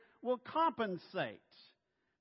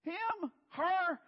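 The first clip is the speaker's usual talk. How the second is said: much higher, shocked squeaky questions; incredulous disbelief; 9 semitones higher, much slower than usual. Retold or imitated. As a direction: gasp in disbelief at who God picked. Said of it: "and you're gonna go".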